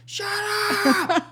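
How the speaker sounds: very high, strained mock scream; retold scream. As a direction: reenact screaming at the top of your lungs. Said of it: "I scream at the top of my lungs".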